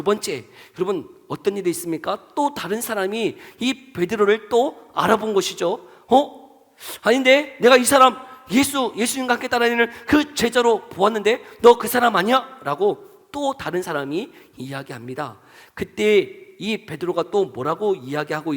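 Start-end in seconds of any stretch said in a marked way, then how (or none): 6.1-12.6 higher, louder suspicious questioning; surprised challenge. Acted. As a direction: act out a bystander loudly recognizing someone. Bystander challenges Peter in retelling. (의심하며 큰 소리로 추궁하듯)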